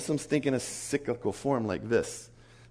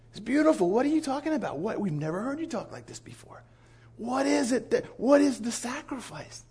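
amazed callers; 9 semitones higher than usual. Retold or imitated.

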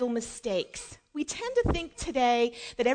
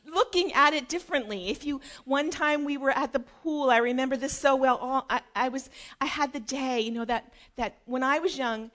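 usual speaking voice

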